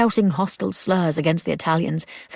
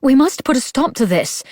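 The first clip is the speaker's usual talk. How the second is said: much higher and louder, determined; rally yourself to act; urgent resolve; 7 semitones higher, 6 dB louder than usual. A narrator's reading. Grace declaring she'll stop the mob, loud.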